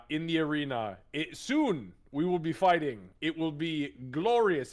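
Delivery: loud, high, swelling showman's boast; grandiose challenger. Acted. Marcus challenges Goliath to the arena, loud and grandiose. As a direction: proclaim it grandly to a rival. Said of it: "turn to the, uh, Goliath and just go".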